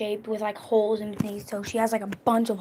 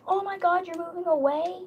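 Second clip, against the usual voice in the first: high-pitched imitated disbelief; mock shock; 8 semitones higher than usual. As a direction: mimic her startled question, rising at the end. Imitates girlfriend's shocked reaction.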